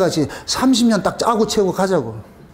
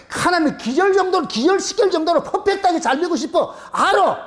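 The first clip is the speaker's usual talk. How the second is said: excited; 9 semitones higher than usual. Real, not acted. Preacher voices congregation's wish.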